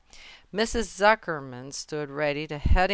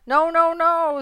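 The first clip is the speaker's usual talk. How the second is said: high, loud protest; urgent high-pitched goose alarm; alarmed warning; 13 semitones higher, 9 dB louder than usual. A narrator's reading. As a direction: cry out to stop a friend. Goose warns pig of trick.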